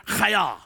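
teaching the crowd a guttural Chaya; throaty Hebrew chaya. Shown as a demonstration. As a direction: lead the room in a rasping Chaya. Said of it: "Everybody on the count of three".

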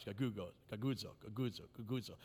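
"gagudzo" said over and over, low and soft like a grumble; "gagudzo" murmured repeatedly so the sound itself grumbles; repeating the word. Shown as a demonstration. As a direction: roll out "gagudzo" again and again under the breath, each time a grumbling sound. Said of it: "just say the word gagudzo four or five times".

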